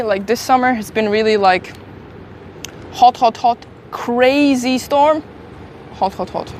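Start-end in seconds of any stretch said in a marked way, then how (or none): none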